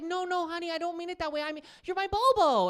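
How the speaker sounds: high-pitched wife impression, pleading sweetly; imitating wife. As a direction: play the wife, pitched well up and insistent. Imitating wife reassuring him in retold exchange.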